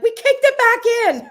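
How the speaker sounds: high, loud imitated blurt; hammed-up excited outburst; mock confessional yell. Acts out friend blurting confession.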